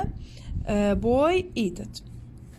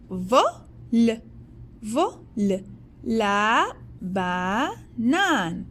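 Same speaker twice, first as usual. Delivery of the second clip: 1 slow teaching repeat; much slower than usual. Shown as a demonstration. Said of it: "slow repeat for pupils".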